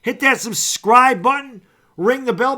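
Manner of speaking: hype announcement. Real, not acted. Hype call to subscribe.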